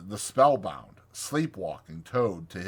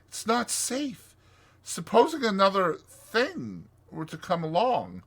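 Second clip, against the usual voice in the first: anxious, pitched-up pleading; 7 semitones higher than usual. A narrator's reading. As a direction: plead worriedly for a friend. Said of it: "asked the Mole anxiously".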